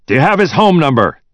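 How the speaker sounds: high, loud, impatient question; urgent demand. A narrator's reading. Agitated boss after slamming down phone.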